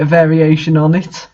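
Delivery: usual speaking voice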